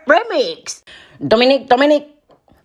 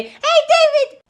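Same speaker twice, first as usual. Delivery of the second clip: high, drawn-out shout of greeting; fan calling out; 3 dB louder, slower than usual. Pretend. Fan spotting him at the event, high excited call. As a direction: call his name out high and long.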